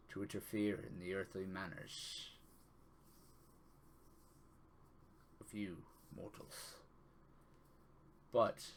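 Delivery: solemn, fatherly god speaking; Tyr's voice. Acted. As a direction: gravely reassure your chosen one. GM voicing the god Tyr.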